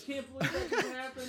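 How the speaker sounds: laughing. Real, not acted. Laughing fit.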